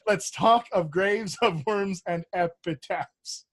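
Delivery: Let's talk of graves, of worms, and epitaphs, slow, each beat even; even iambic beat. Shown as a demonstration. Actor asked to beat out the line for the class. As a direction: beat out the line, everything the same. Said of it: "perform it for us one more time".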